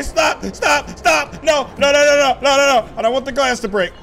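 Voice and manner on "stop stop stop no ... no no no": loud frantic stop, no; panicked shouting. Pretend. Rolling out of control.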